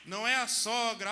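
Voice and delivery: loud, high, emphatic preaching; forceful preaching. Real, not acted. Priest preaching loudly to the women. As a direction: hammer each line at the congregation.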